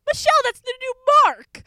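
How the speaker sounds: high, loud thrilled exclamation; reliving the moment of spotting a star; excited recognition. Retold or imitated. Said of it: "and I'm like".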